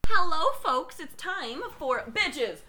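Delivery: slow, loud show-host announcement; announcer voice. Acted. Announcing the quiz segment.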